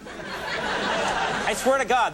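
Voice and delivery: swearing to God through a laugh; laughing disbelief. Real, not acted. Host insists lawsuit is real, laughing. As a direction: laugh while you insist it's true. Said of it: "this is real".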